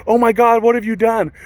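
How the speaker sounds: high, loud gasp of dismay; mock alarm. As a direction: act out the wife's shocked horror. Wife runs up, alarmed.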